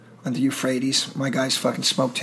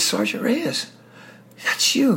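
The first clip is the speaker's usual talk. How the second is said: higher, imitating a captain's delighted surprise; high, lightly voiced captain recognizing him; surprised recognition; 9 semitones higher, slower than usual. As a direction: call it out as you spot an old sergeant.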